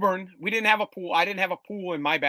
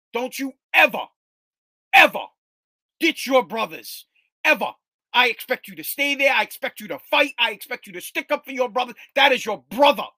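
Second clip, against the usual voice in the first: angry father; 5 semitones higher, 6 dB louder than usual. Retold or imitated. Father telling his son to stand by his brother, loud stern rant. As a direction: drive each word home like a livid dad.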